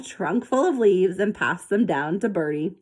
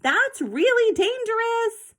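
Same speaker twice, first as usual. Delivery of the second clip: anxious warning; slower than usual. A narrator's reading. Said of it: "Zoe hissed anxiously".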